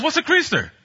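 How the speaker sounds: puzzled question. Retold or imitated. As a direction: ask it totally confused. Puzzled friend quoted.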